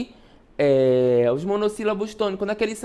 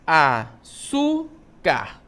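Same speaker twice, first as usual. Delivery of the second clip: "Açúcar" drawn out syllable by syllable, with clear gaps; teacher's syllable-by-syllable "Açúcar"; split into syllables; much slower than usual. Shown as a demonstration. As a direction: separate "Açúcar" very slowly as a-çú-car. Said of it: "como é que eu separo?".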